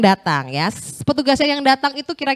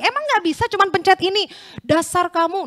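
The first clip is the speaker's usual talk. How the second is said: high-pitched mock scolding; retold cursing; 9 semitones higher than usual. Angry old cleaner insulting the pastor, mock scolding. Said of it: "Dia memaki dengan bahasa Inggris".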